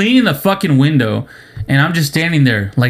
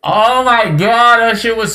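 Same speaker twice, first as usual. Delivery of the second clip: exasperated groan; 7 semitones higher than usual. Real, not acted. Exasperated drawn-out exclamation.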